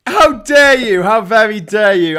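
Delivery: loud, high, hammed-up indignation; mock outrage. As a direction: explode in pretend offence at the insult. Mock offence at boomer jibe.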